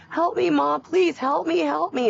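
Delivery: high-pitched retold plea for help; imitated bawling. Mother retelling daughter's cries in the background, imitated bawling. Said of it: "and bawling".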